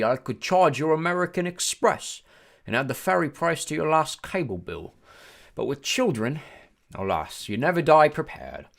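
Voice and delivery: officious British ferryman; British-like accent. A narrator's reading. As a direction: lament airily in a British accent. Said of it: "He had a strange accent. British maybe".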